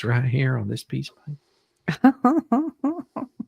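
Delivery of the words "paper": word jumps up high and loud; sudden squeak. Acted. Host finding the last sticker, sudden squeak.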